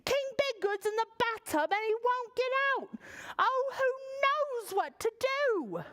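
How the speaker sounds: high, frantic page voice; panicked page. Acted. Page's line continues.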